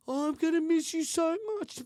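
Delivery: a hint of a weepy ex-wife; mock crying mother. Ex-wife mocked for crying at handover, a touch of fake sobbing. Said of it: "fucking crying in front of your fucking child".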